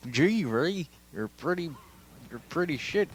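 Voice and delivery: high cartoon dog attempt; Goofy. Cartoon dog appraising Rey on the floor, high silly voice. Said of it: "I tried to do Goofy".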